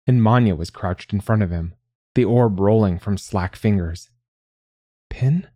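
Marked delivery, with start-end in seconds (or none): 5.1-5.5 high-pitched worried question; high and urgent. A narrator's reading. Manya finding Pinyat collapsed, high and urgent. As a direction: call the name urgently, pitched up. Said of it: "voice high and urgent".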